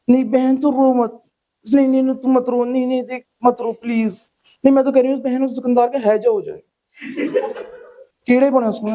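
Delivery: mock consoling woman. Women consoling and cursing shopkeeper.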